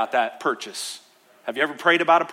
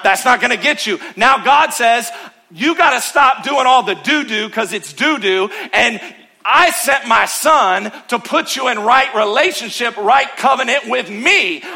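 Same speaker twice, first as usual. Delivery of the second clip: loud, high, driving preacher's voice; preaching at full force; 6 semitones higher, 5 dB louder than usual. Acted. Pastor preaching to church, loud and fervent. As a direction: preach it hard to the congregation.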